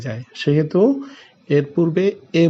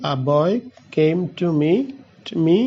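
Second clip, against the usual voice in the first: very slow, word by word dictation; slow example read; much slower than usual. Shown as a demonstration. Teacher reading an example to students, very slow. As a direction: spell the sentence out slowly for the class. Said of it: "একটু উদাহরণে চলে যাই".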